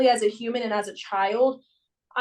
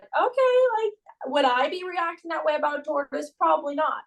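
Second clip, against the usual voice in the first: soothing coach; 5 semitones higher than usual. Retold or imitated. Coach calming sad gymnast, gentle higher voice. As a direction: coax her kindly, pitched up.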